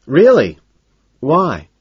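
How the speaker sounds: high, loud surprised question; feigned interest. A narrator's reading. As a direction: ask with bright-eyed curiosity. Narrator feigns interest to owner.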